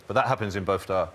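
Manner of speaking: usual speaking voice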